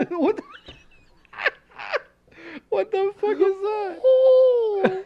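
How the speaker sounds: very high, loud, slow wailing squeal; anime distressed owl. Pretend. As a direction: shriek like a distressed anime girl. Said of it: "like, distressed owl".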